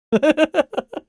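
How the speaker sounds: a high squeaky giggle; bright squeaky laugh. Real, not acted. Laughing before greeting.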